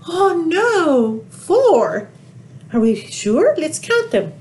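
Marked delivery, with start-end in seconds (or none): 0.0-2.1 mock gasp. Reacting to missing duck for kids.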